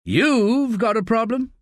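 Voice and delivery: slow, lifted disbelieving question; incredulous echo. A narrator's reading. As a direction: throw the words back in disbelief. Staff echoes the boss, incredulous.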